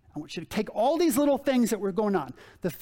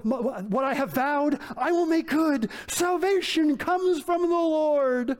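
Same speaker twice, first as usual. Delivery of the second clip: a pleading, frantic Jonah bargaining with God, pitched higher; desperate prayer; 5 semitones higher than usual. Acted. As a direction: beg God like a man trapped in a fish. Pastor acts Jonah's desperate prayer.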